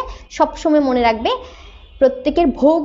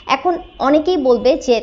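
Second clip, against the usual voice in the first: ordinary delivery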